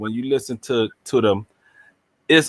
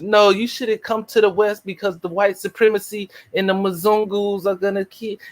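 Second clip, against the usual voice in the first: much higher parody of a preachy activist; mocking preachy voice; 7 semitones higher than usual. Mocking the activist warning people off the West, in a much higher parody voice. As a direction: mimic the activist lecturing Africans. Mocking Pan-Africanist advice.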